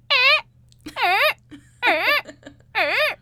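loud, very high creaky squeaks; creaking stair imitation. Drunk person imitating noisy stairs coming home, loud creaking. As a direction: squeak out each step, hammed-up.

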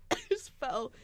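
ordinary talk, nothing marked

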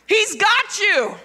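high, loud, slow declaration; emphatic proclamation. Real, not acted. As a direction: proclaim it slowly at full force. Climax line, then repeated calmly.

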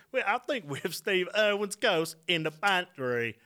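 bad Australian-British accent. Co-host folds ghost into earlier skit, slow bad Australian-British. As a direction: draw it out in a hammy Aussie-Brit accent.

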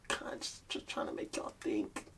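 soft, breathy whispered afterthought; whispering. Acted. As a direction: whisper it like a secret. Second take, whispered.

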